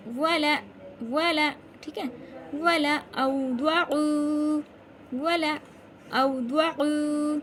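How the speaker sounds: loud, very slow chant; recitation demo. Shown as a demonstration. Teacher showing how to read it, loud slow recitation. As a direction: hold the long syllables, strong teaching voice. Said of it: "कैसे पढ़ेंगे?".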